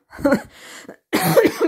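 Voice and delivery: rough dry cough. Real, not acted. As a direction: cough into the mic. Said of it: "je tousse encore".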